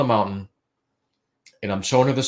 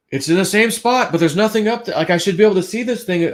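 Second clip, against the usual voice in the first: spooked; 9 semitones higher, 4 dB louder than usual. Retold or imitated. Spooked by unseen breathing again.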